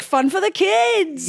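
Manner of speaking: high, slow and energetic; a goofy energetic kids' ad read. Shown as a demonstration. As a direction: sell it to the kids, goofy.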